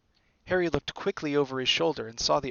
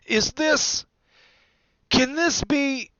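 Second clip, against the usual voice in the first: high, halting disbelief, louder; awestruck; 14 semitones higher, 8 dB louder, much slower than usual. A narrator's reading. Bartender recognising Harry, high and awestruck. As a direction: falter in amazement, stretching the words.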